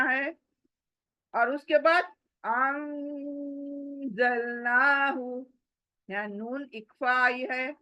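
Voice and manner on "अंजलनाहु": अंजलनाहु very slow, with the noon hidden and carried through the nose; nasal ikhfa noon. Shown as a demonstration. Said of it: "वो नून को नाक में लेकर जाना है".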